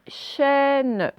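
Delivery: "chaîne" drawn out with a falling tune; clear dictation word. Shown as a demonstration. Teacher dictating a word to pupils for sound spotting, clear dictation. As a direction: say "chaîne" slowly and clearly for listeners. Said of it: "je vais vous dire le nom des choses".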